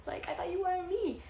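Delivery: high-pitched mock bewilderment; baffled mom. Mom hearing daughter wants the dog, high baffled imitation. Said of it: "she's like".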